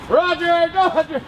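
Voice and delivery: lightly voiced radio host, drawn out. Shown as a demonstration. DJ pleading to be recognised, mock radio voice. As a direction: lean a little into the on-air voice. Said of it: "You know the voice?".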